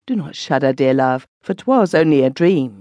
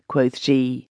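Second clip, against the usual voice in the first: plain reading voice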